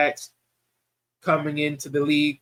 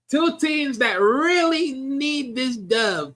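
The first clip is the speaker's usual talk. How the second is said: slow, drawn-out and higher, savouring each word; slow dramatic emphasis, much higher pitch; drawn-out hype; 7 semitones higher, much slower than usual. Acted. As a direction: stretch it out like a big announcement. Dramatic hype of a big matchup.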